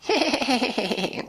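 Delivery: high nasty mocking laugh; a mean horse's cackle. Nightmare horse laughing nastily at the pufferfish. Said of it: "laughed in this nasty kind of laugh".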